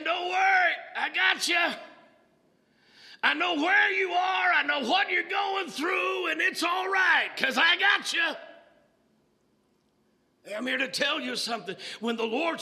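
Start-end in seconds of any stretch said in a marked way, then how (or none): 0.0-8.3 loud, high call of comfort; booming promise from the pulpit; shouted reassurance. Acted. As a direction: proclaim it over the congregation. Preacher voicing the Lord.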